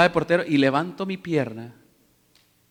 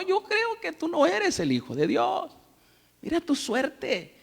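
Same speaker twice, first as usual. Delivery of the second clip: a mocking tempter, rising higher and louder into a sneer; Satanás taunting; 9 semitones higher, 9 dB louder than usual. Satan taunting hungry Jesus in the desert, mocking and sneering. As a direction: needle him, doubting and scornful. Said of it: "le dijo".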